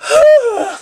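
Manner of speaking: high, loud swooning sigh; fainting sigh. A narrator's reading. Ashley faints dramatically after the fight, swooning sigh. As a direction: swoon with a long exhaled cry. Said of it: "I'm gonna faint".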